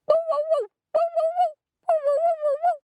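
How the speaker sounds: high yelping dog barks; barking. A narrator's reading. Little dog Pip barking herself awake. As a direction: bark loudly like a little dog to wake up. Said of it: "barked to wake up".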